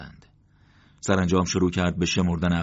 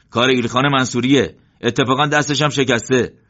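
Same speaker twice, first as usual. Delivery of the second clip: loud, higher call across the trench; calling back; 6 semitones higher, 6 dB louder than usual. A narrator's reading. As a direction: shout the answer over. Isa calls back across trenches.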